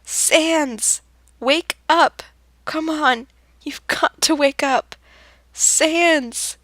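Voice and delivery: high, loud desperate yelling; screaming. Acted. Frisk screaming to wake Sans from a nightmare, high and loud. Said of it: "They scream over and over".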